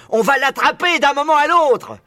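cri beaucoup plus aigu et fort; hurlant. A narrator's reading. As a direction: hurler l'ordre aux autres fermiers. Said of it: "hurlait le gros Boggies". (Boggies hurle sur Buns et Bean, très aigu et fort)

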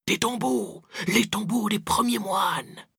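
put-on high, excited boy's exclamation; uncontained outburst. A narrator's reading. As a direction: blurt it out high, stretching the end. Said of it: "Pépé ne se contint plus".

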